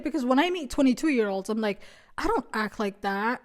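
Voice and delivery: ordinary talk, nothing marked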